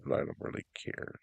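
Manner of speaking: a guilty whispered confession; whispered aside. Real, not acted. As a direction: whisper it, slightly fast. Confesses he doesn't care.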